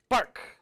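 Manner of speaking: a sharp barked "Bark!" like a seal. Retold or imitated. Telling how the carved seal barked, sharp bark. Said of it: "Like a seal does".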